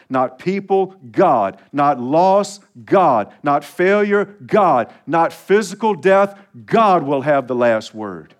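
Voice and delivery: loud, punchy pulpit refrain, each God hit hard; fervent sermon climax; preaching at full force. Acted. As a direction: hammer every God like a gavel. Preaching climax, call and response.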